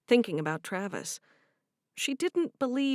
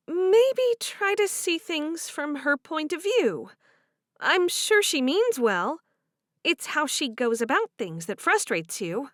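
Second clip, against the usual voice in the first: higher, warm voiced heroine; 7 semitones higher, 4 dB louder than usual. Cherish offering to do the dishes, higher and bright. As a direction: speak up brightly to her friend.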